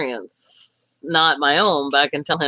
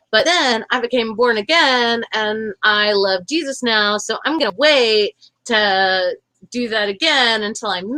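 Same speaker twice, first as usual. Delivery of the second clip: higher, slow, sugary mock-pious voice; preachy cartoon chick; 8 semitones higher, slower than usual. Mocking born-again cartoon chick, higher, slow and sweetly preachy. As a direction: drawl out the sermon like a saccharine church girl. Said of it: "another chick says to her".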